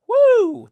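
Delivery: high, loud whoop. Real, not acted. Vlogger shaking off an awkward realization with a loud high whoop.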